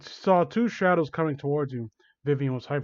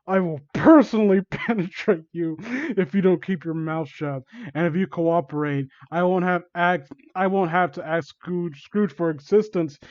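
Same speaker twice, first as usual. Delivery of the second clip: plain reading voice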